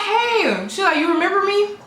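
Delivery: high-pitched, sing-out greeting; friendly greeting. Retold or imitated. Church lady surprising her at the door, high friendly greeting.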